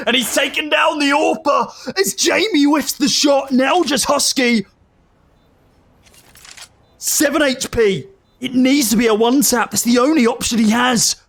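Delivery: excited esports play-by-play; rising hype, call the clutch; esports caster. Real, not acted.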